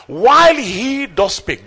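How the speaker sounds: slow scripture reading. Real, not acted. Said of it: "reading scripture slowly".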